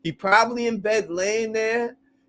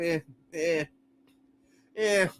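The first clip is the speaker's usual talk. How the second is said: ordinary talk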